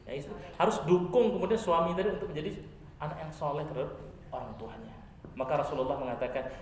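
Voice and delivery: usual speaking voice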